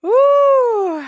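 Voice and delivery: high, loud whoop Woo; mock cheer. Real, not acted. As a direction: whoop it out like a fan. Cheering the lyric.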